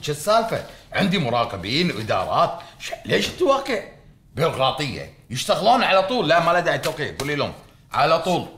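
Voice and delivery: loud, high-pitched bossy outburst; exasperated rant. Acted. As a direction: rail at staff over needless red tape. Boss ranting at staff over signatures.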